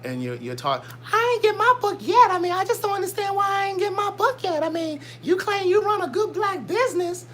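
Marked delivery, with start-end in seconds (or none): 1.1-7.3 very high, put-on whiny customer voice; mock falsetto complaint; high-pitched complainer. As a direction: mimic a griping customer way up high. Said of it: "I imagine your voice is high-pitched".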